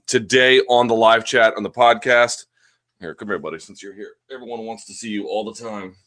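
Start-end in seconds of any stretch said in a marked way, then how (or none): 3.0-5.9 much softer, off-hand sweet talk to an animal; quiet, private murmur to a buddy; soft aside to a pet. Real, not acted. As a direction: softly coax a pet over. Talking to pet during live chat.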